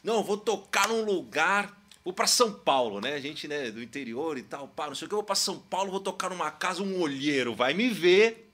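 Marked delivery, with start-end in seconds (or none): 0.0-3.0 excited. Retold or imitated. Voicing naive young musician's dream. 5.1-8.5 excited. Retold or imitated. Voicing naive young musician's dream.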